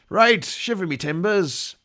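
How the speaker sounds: put-on loud, slow pirate cry in a pirate accent. Commentator hamming it up for viewers, loud slow pirate accent. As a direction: bellow it across the deck like a pirate captain. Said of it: "is the accent hurting your throat yet".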